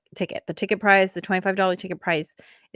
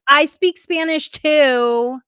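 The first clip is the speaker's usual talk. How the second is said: slow, pointed retort; 6 semitones higher, 6 dB louder, much slower than usual. Retold or imitated. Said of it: "the other person was like".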